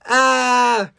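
high-pitched acted alarm; high storybook fright; alarmed cry. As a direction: yelp the question in a panic. Asked Tyler.

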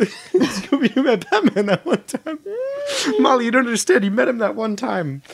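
high, laughing, half-crying insistence; mock anguish. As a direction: plead like it truly matters, breaking up. Passionate mock-desperate plea to Molly.

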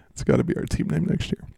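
normal talking voice

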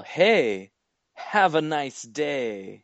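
high, drawn-out called farewell; mock yell. Podcaster delivering a movie's final line, mock yell. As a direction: call it out slowly, stretching the words. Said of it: "I still have to yell".

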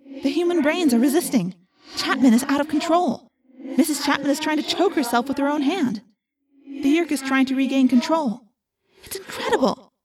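amazed, echoing running report; urgent report. A narrator's reading. Narrator telling Jake of the struggle, urgent and excited.

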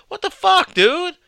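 mock angry outburst. Joking with his British friend, mock outraged yell.

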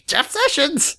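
high parrot squawk; talking bird. Acted. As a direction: screech the name like a cockatoo. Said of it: "most birds are like".

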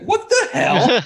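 very high, loud laughing outburst; shocked. Retold or imitated. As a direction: laugh it out in disbelief. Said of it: "I'm like".

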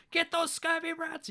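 a hammed-up captain barking orders; Hook's order. Hook ordering his crew, high, slow and loud. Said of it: "Hook gets his hook caught".

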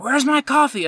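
high-pitched imitation of a yelling customer; mock yell. Customer yelling at manager for coffee, high mock yell. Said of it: "yelling at me".

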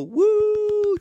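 high, loud whoop of mock admiration; a crowd's hammed-up whoop; mock cheer. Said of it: "And people go".

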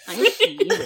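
put-on high babyish voice. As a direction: squeak it like a toddler. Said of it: "Just little baby voice".

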